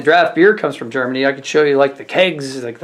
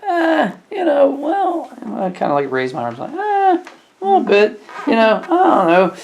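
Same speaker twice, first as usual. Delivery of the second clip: mock high, unsure hedging voice; high unsure shrug; 11 semitones higher than usual. Host shrugs at customer's parking question, high and unsure. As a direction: squeak it out, arms raised and unsure. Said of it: "So I said".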